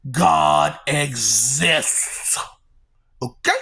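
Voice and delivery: weighty, slowed declaration; slow solemn. Real, not acted. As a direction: drop it low and let it land. Slow low pronouncement.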